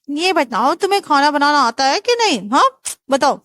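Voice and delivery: nosy aunt questioning the groom; bossy aunt. Acted. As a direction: grill the boy like a nosy aunt.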